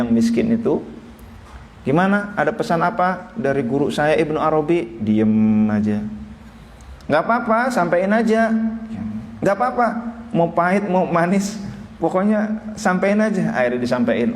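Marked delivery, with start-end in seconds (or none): 1.9-13.5 high, coaxing insistence; a teacher begging his student to pass on the news; eager old teacher. Retold or imitated. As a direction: press the student to spill the message. Poor teacher eagerly urging the student.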